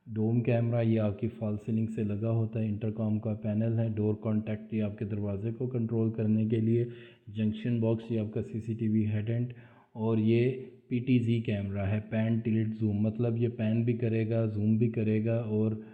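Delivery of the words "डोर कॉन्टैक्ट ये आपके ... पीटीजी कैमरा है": normal talking voice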